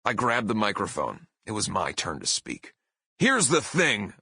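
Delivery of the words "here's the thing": higher, punchy wrestler-promo opening; pro wrestler bellow. Retold or imitated. Singer grabbing the microphone before a hostile crowd, wrestler bellow. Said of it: "bellowed in my best professional wrestler voice".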